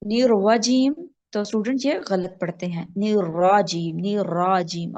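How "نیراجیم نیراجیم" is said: نیراجیم with a clean open ra and no w, slowly; correct unrounded ra. Shown as a demonstration. Teacher modelling the correct نیراجیم slowly. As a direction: keep the lips flat on نیراجیم. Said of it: "را کے اوپر زبر ہے".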